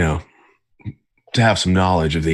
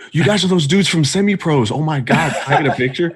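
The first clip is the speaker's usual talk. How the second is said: much higher, excited gushing fan voice; starstruck fan; 9 semitones higher than usual. Retold or imitated. Mocking the door lady as an excited fan, high and gushing. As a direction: gush like a starstruck fan, laughing. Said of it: "She was like".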